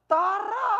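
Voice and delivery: loud, high, drawn-out call; hammed-up happy shout; overjoyed father. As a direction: call out joyfully to your daughter. Father joyfully greets his daughter.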